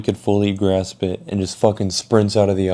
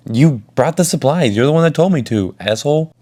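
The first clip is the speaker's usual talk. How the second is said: higher-pitched, aggrieved blame; worked-up, pitched-up accusation; indignant accusation; 6 semitones higher, 4 dB louder than usual. Retold or imitated. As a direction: throw the blame back at a friend. Argues against the friend's betrayal.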